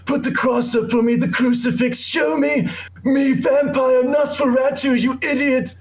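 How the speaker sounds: frantic high-pitched mock shriek; hammed-up raving vampire, far above normal pitch; Nicolas Cage impression.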